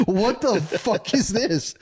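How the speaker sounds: high, drawn-out mock astonishment; retold appalled outburst; shocked disbelief. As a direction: stretch it out high, aghast, nearly laughing. Retold shocked reaction to decapitated dogs.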